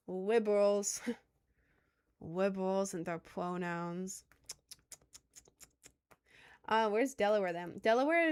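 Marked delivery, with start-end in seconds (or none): 0.1-4.2 "Liberals" said as "Wibewals", with w for l; joking, slow "Liberals", its l turned to w; mock speech impediment. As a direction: say "Liberals" with a babyish w in place of the l. Mocking phrase with w for l.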